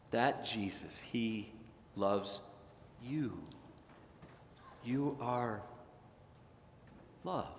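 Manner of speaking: quiet, intimate pastoral reassurance; tender assurance. Acted. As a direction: lay each word down gently for the listener. Tender climax of the sermon.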